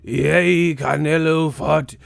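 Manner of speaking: loud, slow Gettysburg-style oration; hammed-up historical narrator; mock old narrator. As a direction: orate it like a solemn old speech. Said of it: "old narrator sounding".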